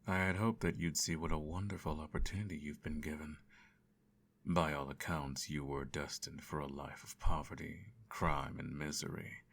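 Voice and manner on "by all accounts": normal talking voice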